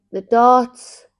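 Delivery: loud drowsy answer; sleep talking. Retold or imitated. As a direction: blurt it loud, half asleep. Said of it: "And you went".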